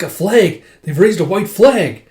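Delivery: high, loud acted exclamation; astonished shout. Soldiers spotting a flag of surrender, astonished shout. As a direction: cry out at a startling sight.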